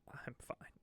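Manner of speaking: breathy whisper of I'm fine. Pretend. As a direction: murmur I'm fine under your breath. Whispered reassurance after crisis joke.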